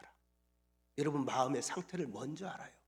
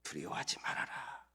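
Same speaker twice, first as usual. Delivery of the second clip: calm warm voice; 4 semitones lower than usual. Pretend. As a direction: speak it like a gentle father comforting a child. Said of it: "아주 차분한 목소리로, 따뜻한 목소리로".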